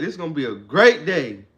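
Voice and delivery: confident morning vow; with conviction. Shown as a demonstration. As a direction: declare the day yours. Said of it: "say it with conviction".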